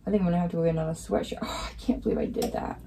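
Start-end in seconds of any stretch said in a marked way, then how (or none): 1.4-2.8 breathy half-voiced disbelief; whispered self-reproach; dismayed under breath. Real, not acted. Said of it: "I'm so mad at myself".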